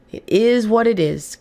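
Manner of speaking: drawn-out resigned acceptance; slow resigned take. Real, not acted. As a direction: let it land slowly, accepting it. Repeats the phrase twice.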